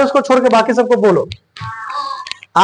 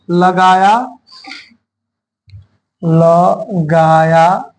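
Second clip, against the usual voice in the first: लगाया stretched very slowly with a lower pitch; slow stretched word; 4 semitones lower, much slower than usual. Shown as a demonstration. Teacher dictating slowly to class. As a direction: drag out लगाया syllable by syllable. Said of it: "teacher stretching word slowly".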